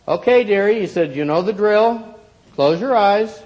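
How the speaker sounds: put-on a cheerful old shopkeeper humoring her. A narrator's reading. Shopkeeper calls her dearie.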